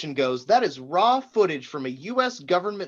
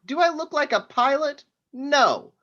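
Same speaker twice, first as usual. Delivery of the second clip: high, scornful put-down; sneering boss mocking the agent's request; scornful mockery; 9 semitones higher than usual. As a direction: scoff at the dumb question. Mocking the question.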